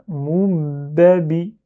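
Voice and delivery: "Moubabi" slowly, first b held as "moub-babi"; doubled b. Shown as a demonstration. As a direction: linger on the closed b inside "Moubabi". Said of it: "le ba avec kasra à la fin".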